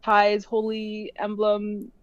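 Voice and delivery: ordinary talk, nothing marked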